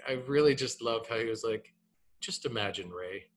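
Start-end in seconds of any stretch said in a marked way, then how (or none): 2.2-3.3 a hint of Alan Watts, unhurried and amused; Alan Watts imitation. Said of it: "I won't try to do his voice".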